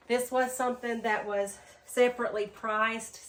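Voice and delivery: ordinary talk, nothing marked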